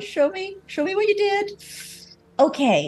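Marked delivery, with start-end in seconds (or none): 0.7-1.6 high, demanding. Acted. Character demands to see work.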